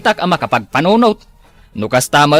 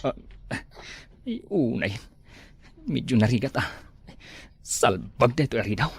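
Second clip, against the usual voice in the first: much softer, low careful voice; hushed sneaking; 9 semitones lower, 16 dB quieter than usual. Acted. Husband sneaking home late at night, hushed and soft. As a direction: sneak in without waking anyone.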